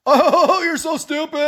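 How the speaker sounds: high, loud, sneering mock voice with a laugh; mocking name-caller. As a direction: mimic a troll jeering at him, laughing. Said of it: "some name calling".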